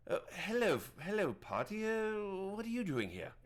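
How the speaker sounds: high-pitched hermit druid, delighted; surprised, happy greeting in a put-on high voice; Prattis. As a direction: greet old friends as an eccentric hermit. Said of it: "I don't remember his accent".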